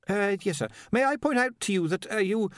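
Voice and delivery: high, hesitant deferential voice; hesitant underling. A narrator's reading. Ponder tries to point something out to his master, hesitant. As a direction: edge politely toward an awkward point.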